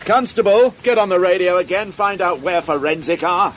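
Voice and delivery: calling out. Acted. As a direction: call the constable over, loud and high.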